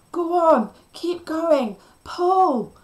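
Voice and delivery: high, loud, desperate yelling; frantic high shouts, each falling off; screamed. A narrator's reading. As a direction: scream urgent commands. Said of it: "I screamed".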